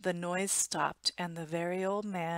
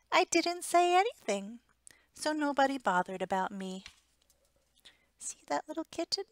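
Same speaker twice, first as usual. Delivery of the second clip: small kitten voice; 6 semitones higher than usual. Acted. The homely kitten speaks.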